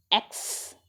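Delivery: एक्स breathed out softly without voice; letter sound demo. Shown as a demonstration. Teacher shows pupils the sound of x. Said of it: "ये किसकी sound होती है?".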